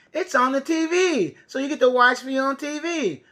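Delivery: ordinary talk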